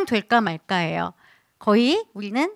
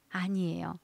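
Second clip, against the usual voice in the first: usual speaking voice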